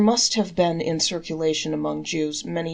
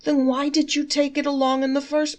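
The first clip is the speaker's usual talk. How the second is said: much higher, louder baffled question; the first sage asks why he brought it; exasperated fool; 9 semitones higher, 4 dB louder than usual. Acted. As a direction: demand an explanation. Fools argue about the umbrella.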